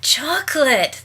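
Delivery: slow, high, delighted cry of "Chocolate"; high excited "Chocolate" from the two sisters; exclaimed. Acted. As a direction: gasp it out as if the word itself were a treat. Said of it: "they exclaimed at the same time".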